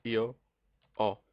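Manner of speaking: "ho" said as a bare "o", no H sound; silent H. Shown as a demonstration. Teacher demonstrating Italian "io ho" to learners, "ho" with silent H. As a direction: say "ho" without any H, just the vowel "o". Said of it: "I do not pronounce the H".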